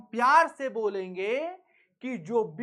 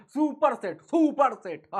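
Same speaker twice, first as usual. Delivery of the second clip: laughing; 3 semitones higher, 6 dB louder than usual. Real, not acted. Teacher laughing over the term.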